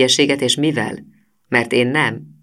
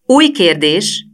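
slow, high and loud announcement; calling out; 6 semitones higher, 6 dB louder, much slower than usual. A narrator's reading. Girl announcing the next quiz question, loud and slow. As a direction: stretch it out and call it across the hall. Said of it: "kiáltott Zsuzsanna".

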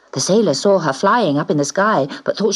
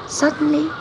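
plain reading voice

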